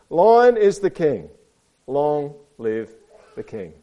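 storybook herald winding down; grand proclamation. A narrator's reading. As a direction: proclaim slowly, then settle into reverence. Animals proclaim the lion king.

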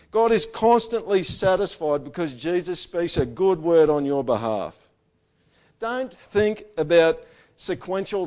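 loud, high, driving pulpit voice; preaching at full force. Acted. Pastor urging the congregation on Jesus' intercession, preaching at full force. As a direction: hammer it home to the whole church.

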